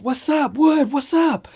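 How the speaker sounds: high call down the hallway. Retold or imitated. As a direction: shout to a friend far off. Calling to friend across hall.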